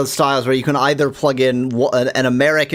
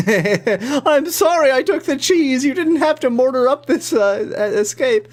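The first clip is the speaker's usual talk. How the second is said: mock apology in a high voice; Graham's apology; 9 semitones higher than usual. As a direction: plead like a sheepish hero. Voicing Graham apologizing to mouse.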